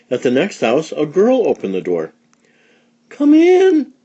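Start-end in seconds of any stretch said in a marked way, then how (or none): 3.1-3.9 high, loud welcoming cry; calling them in to the party; excited call. A narrator's reading. Girl cries out to invite them in, high and loud. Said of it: "she cried".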